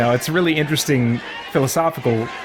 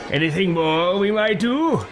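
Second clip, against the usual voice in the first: high, put-on villain question; eager game character; 5 semitones higher than usual. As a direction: ask with mock courtesy, pitched up. Same character voice to ally.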